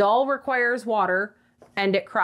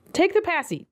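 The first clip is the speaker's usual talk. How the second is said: coaxing offer; 9 semitones higher than usual. Real, not acted. Offering pacifier to crying doll.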